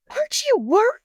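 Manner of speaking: high, loud alarmed question; freaking out. Retold or imitated. Daughter freaking out at hospital.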